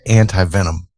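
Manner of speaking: long I ending anti in ANTI-VENOM; AN-tie pronunciation. Shown as a demonstration. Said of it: "that's how I say it".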